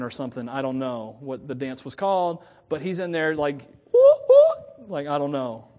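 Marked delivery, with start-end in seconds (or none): 3.9-4.9 squealed high, loud whoops. Retold or imitated. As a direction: yelp it like a dancing baby. Baby dancing in the womb.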